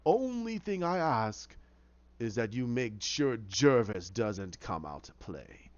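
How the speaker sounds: slow, theatrical villain with swooping pitch; the Hatter. Retold or imitated. Hatter's recorded offer to Oswald, slyly theatrical. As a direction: savour each word, dropping low at the ends. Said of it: "he records, he goes".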